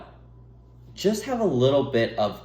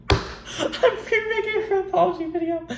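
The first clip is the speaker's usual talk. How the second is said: squeaky laughing high voice; giggling mock apology pitched high; laughing high squeal. Real, not acted.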